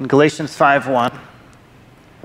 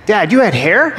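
higher, louder mock kid voice; kids teasing; 7 semitones higher, 4 dB louder than usual. Father imitating his kids teasing about his old photo, mock child voice. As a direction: squeak it like a cheeky child. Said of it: "they say".

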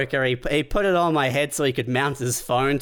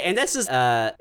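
ordinary talk

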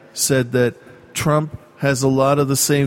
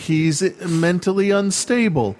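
higher-pitched exasperation at normal volume; mock inner yelling; 7 semitones higher than usual. Answering a Trump supporter in his head, mock exasperated yell. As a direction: imitate yelling inside your head, pitched up. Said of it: "I'm yelling in my head".

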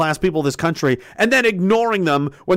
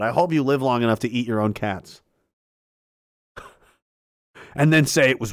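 ordinary delivery

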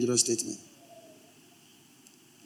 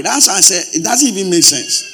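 raised, louder outcry of disbelief; loud exclamation; 5 semitones higher, 9 dB louder than usual. Real, not acted. Preacher to congregation, loud exasperated outcry.

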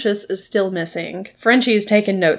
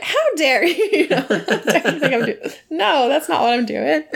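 high-pitched, laughing outrage; mock indignation; 6 semitones higher than usual. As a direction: play offended, barely holding back laughter. Acted indignation at being misunderstood.

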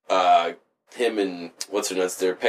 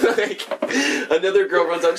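ordinary delivery

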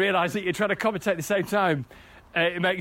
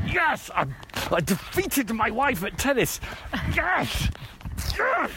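triumphant; 4 semitones higher, slower than usual. Real, not acted. Celebrating beating wife at tennis.

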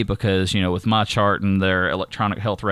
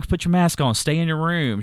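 higher-pitched, mildly scolding retold order; retold fussing; 7 semitones higher than usual. Fussing at a housemate to isolate, mildly scolding. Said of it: "I have to fuss at her".

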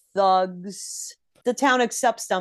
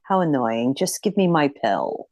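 low, weary exasperation; lower-pitched, put-upon annoyance; exasperated; 8 semitones lower than usual. Acted. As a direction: play someone fed up with the hassle. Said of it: "kind of exasperated".

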